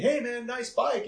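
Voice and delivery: drawn-out high friendly greeting; slow, sing-song "hey man"; mock passerby. Mocking a passerby's compliment.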